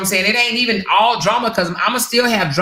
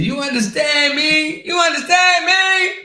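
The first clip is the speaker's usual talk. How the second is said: slow, then high mocking shout; hammed-up quote of a rival, rising to a shout; mocking imitation; 9 semitones higher than usual. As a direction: drag the first one out, then shout the second high. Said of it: "She said".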